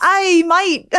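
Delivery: loud, high mock Australian greeting; Australian accent. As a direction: put on a playful Australian accent. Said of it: "do your Australian accent next".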